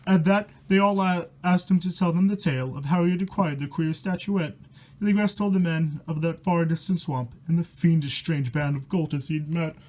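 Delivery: steady reading of a spooky rhyme; rhyming story reading. A narrator's reading. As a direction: read the verse out like a bedtime horror tale. Reads rhyming horror book aloud.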